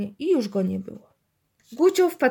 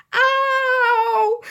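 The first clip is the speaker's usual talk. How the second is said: high, loud drawn-out yowl; piercing "ow"; yelp; 13 semitones higher, 5 dB louder than usual. A narrator's reading. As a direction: cry out in shock at icy water. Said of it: "zawołał".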